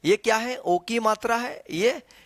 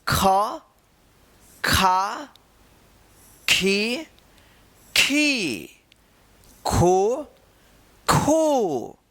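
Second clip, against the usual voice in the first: recitation drill; much slower than usual. Shown as a demonstration. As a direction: drill the syllables one by one for students. Recites barakhadi syllables.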